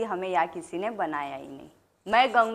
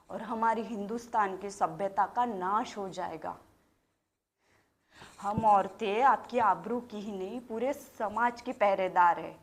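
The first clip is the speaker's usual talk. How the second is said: hushed, lowered solemn declaration; 3 semitones lower, 8 dB quieter than usual. Acted. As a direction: drop the voice for a grave point. Solemn warning, guardians of society.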